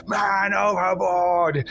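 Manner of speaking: long, drawn-out shout; yelled alarm. A narrator's reading. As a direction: raise the alarm across a storm-tossed deck. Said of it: "Blackwood yell".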